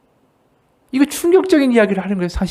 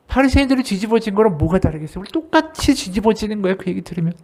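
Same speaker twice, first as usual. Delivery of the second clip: ordinary delivery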